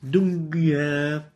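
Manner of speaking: dunya dicho lento como "dunga"; slow, nasal "dunga" for dunya; nasal gunna. Shown as a demonstration. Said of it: "not dunya".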